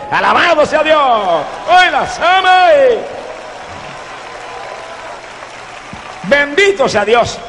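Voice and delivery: high, exultant cries of praise; fervent preacher's cries; praise shout. Real, not acted. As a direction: burst into high-pitched praise at the climax.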